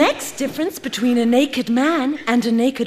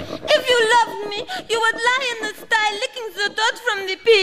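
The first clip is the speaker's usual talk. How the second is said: put-on higher, tearful French accent; whining French plea; 7 semitones higher than usual. Claudette begs Stuart to lick the pig dirt, tearful high French accent. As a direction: plead in a high, half-crying French voice. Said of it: "in a French accent".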